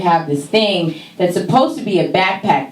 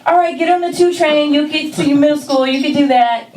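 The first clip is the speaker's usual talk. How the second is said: higher brisk mom voice; lightly voiced mother sending her kid off; mother's instructions; 9 semitones higher than usual. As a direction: hand off the subway directions like a breezy mom. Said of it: "she's like".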